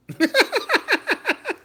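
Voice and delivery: loud cackling laugh after the threat; high burst of laughter. Real, not acted. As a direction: break into a big high laugh.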